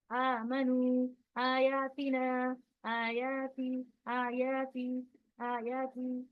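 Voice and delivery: slow, even recitation with आयाती drawn out; stretched long vowels. Shown as a demonstration. Teacher applying the rule just taught, stretched long vowels. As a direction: hold each "aa" and "ee" of आयातिना two beats. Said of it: "इसको भी दो हरकत खींचना है हमें".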